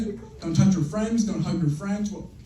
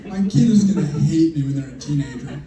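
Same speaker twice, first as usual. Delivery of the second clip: ordinary talk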